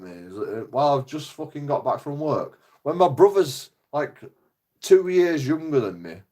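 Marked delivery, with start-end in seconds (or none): none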